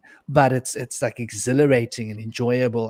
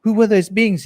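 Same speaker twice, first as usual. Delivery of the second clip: higher, slowed, rising to louder panicked questions; imitating her terrified pleading; frightened crying; 5 semitones higher, 4 dB louder than usual. Ex-wife in shock after the beings, frightened and pleading. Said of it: "And she's crying".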